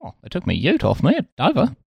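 high, badly faked New Zealand accent; mock New Zealand accent. Redoing the ute line as New Zealander, mock accent. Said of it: "That's not New Zealand".

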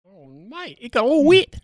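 high-pitched put-on New Zealand accent; squeaky-high British voice drifting New Zealand; mock New Zealand accent. Joking about drifting towards New Zealand, mock accent. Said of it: "trending towards New Zealand".

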